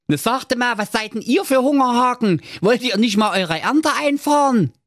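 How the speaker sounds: put-on high cheeky young lad; Schnitzelschorsch voice. A narrator's reading. Narrator voicing Schnitzelschorsch, a cheeky high voice. Schorsch teases villagers.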